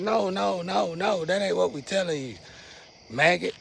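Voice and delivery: high, insistent string of no's; high-pitched telling-off from an ancestor; scolding ancestor. Retold or imitated. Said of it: "my ancestor said".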